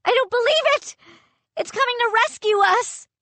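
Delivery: high, loud disbelief; overjoyed. Acted. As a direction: shout in joyous disbelief. Narrator overjoyed at rescue.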